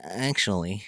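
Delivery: lightly voiced pedantic nerd; a hint of a know-it-all correcting someone; nerd impression. Mocking own camera nerdiness to co-host, light nerd voice. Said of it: "nerds don't sound like that".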